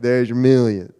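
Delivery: imitating a Tennessee country accent, slow and drawn out; Southern country accent on "There's your million."; thick Southern country drawl. Quoting a Tennessee friend's million-dollar formula, Southern country accent. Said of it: "That's how he said it".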